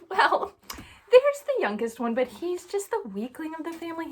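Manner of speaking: high, loud scoffing dad; dismissive Jesse. Acted. Jesse dismissing his youngest son, loud and scoffing. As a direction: brush him off with a laugh.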